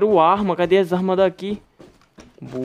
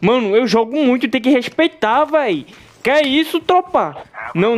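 hyped bragging; 8 semitones higher, 3 dB louder than usual. Real, not acted. Boasting after kill.

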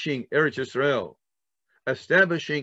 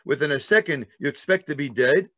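ordinary delivery

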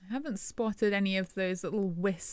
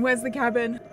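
ordinary talk, nothing marked

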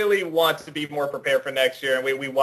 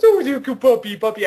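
imitating puppy talk; 6 semitones higher, 4 dB louder than usual. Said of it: "She always goes".

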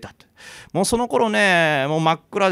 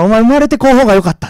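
louder and higher, menacing crone; escalating curse; 5 semitones higher, 11 dB louder, faster than usual. Retold or imitated. Old woman presses the curse on the girl, rising menace. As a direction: build the curse to a vicious peak.

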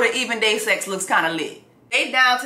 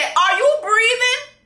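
high, slow outraged disbelief; outraged high question; 11 semitones higher, 4 dB louder, slower than usual. Retold or imitated. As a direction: squeal it slowly in disgust. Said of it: "She said".

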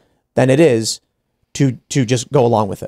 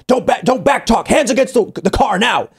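stern order; 9 semitones higher than usual. Retold or imitated. Stranger repeating order to kids.

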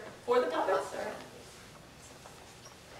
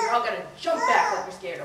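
normal talking voice

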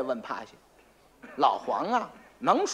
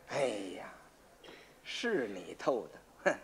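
故作深沉; 5 semitones lower, 7 dB quieter than usual. Acted. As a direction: draw it out to scare him. 老黄装腔作势吓唬崔英. (假装高深，低沉慢慢说)

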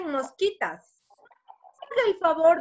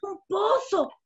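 startled discovery; 4 semitones higher, slower than usual. Acted. Fly looks down and finds a well, surprised. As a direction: react amazed, for young listeners. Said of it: "regresó a ver y dijo".

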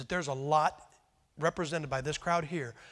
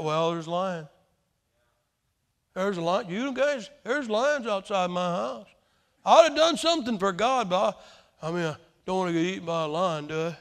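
whiny excuse maker; 4 dB louder than usual. Retold or imitated. Preacher mimics fearful excuse-maker.

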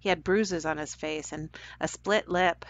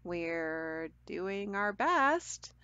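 a little confused; 5 dB quieter, much slower than usual. Acted. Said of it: "a little confused".

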